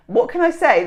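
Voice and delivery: "What can I say?" lifted high and loud on I. Shown as a demonstration. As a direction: pitch it up, hit I. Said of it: "repeats line louder".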